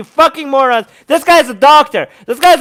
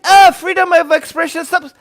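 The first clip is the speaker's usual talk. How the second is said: higher-pitched mock complaint; mocking imitation; 5 semitones higher than usual. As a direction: mimic an opponent scornfully. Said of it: "you're like".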